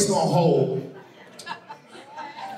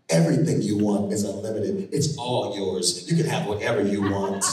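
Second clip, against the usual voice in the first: seductive agent; 13 semitones lower than usual. Retold or imitated. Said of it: "This is what she do".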